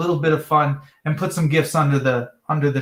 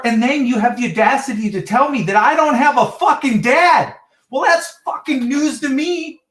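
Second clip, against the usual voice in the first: worked-up, pitch climbing high; retold indignant outburst; 7 semitones higher than usual. Caller confronting dispatcher about the dad remark, retold indignant outburst. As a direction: re-enact the outrage, voice rising as it goes.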